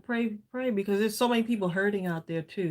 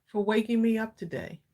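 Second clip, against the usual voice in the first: prayer; 7 semitones lower, 5 dB quieter than usual. Real, not acted. Praying together.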